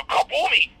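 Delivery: high mock kaboom; explosion noise. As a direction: blurt a cartoon blast. Acting out an explosion.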